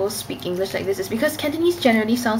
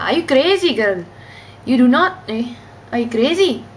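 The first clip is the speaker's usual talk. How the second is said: put-on high, loud scolding in an Indian accent; 4 semitones higher, 4 dB louder than usual. Joking with friends about being crazy, Indian accent. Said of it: "the Indian accent".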